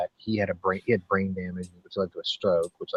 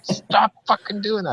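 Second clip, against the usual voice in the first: high, loud, slow mock scolding; exasperated retold yell; 14 semitones higher, 6 dB louder, slower than usual. Retelling scolding the terrier under the bed, exasperated high yell. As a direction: act out yelling at a misbehaving dog.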